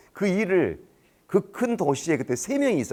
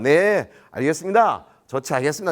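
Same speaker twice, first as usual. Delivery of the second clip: scripted phone answer; 4 dB louder than usual. Acted. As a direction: stretch the yes and breeze through it. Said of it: "이렇게 다 넘어가".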